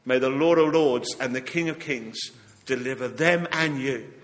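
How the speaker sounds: ordinary talk, nothing marked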